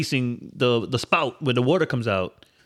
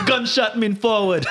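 put-on slow, higher Jamaican deejay accent, amused; 6 semitones higher, slower than usual. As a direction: hype it up in mock Jamaican patois. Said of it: "Jamaican, Gas, Jamaican".

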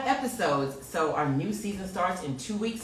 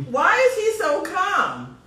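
higher, louder, disbelieving; amazed; 11 semitones higher, 7 dB louder than usual. Real, not acted. Host marvels at her calm, soaked guest, high and loud. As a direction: ask it in amazement. Guest unfazed by water attack.

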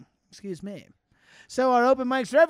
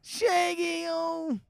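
ordinary talk

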